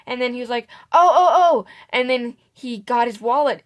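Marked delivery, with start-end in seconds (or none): 0.9-1.6 sudden high, loud oh-oh-oh of remembering; old customer blurts out as if he's just remembered, louder; startled oh. Retold or imitated. Old customer remembering his card at the till, a startled higher outburst.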